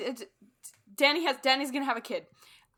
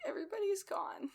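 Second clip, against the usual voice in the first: whispered; 9 semitones higher, 6 dB quieter than usual. Retold or imitated. Reading Rickon's POV, a small whispered voice. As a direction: pretend little brother whispering, high. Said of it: "he's like".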